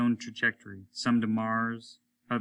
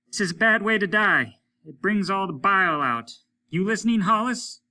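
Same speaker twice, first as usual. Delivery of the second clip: higher, louder gruff voice of Applegate; a rough spaceman calling in, raised voice; Applegate over the radio; 9 semitones higher, 7 dB louder than usual. A narrator's reading. Applegate calling Hollis over the radio, higher and louder.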